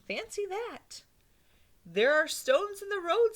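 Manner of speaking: put-on high old lady marvelling; old lady amazed then offering stones. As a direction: pitch it up in wonder.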